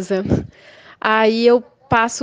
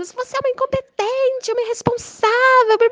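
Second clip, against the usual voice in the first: much higher shrill insults; caricatured furious neighbor; 14 semitones higher than usual. Retold or imitated. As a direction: sneer the insults in a high squeal. Neighbor banging table insulting.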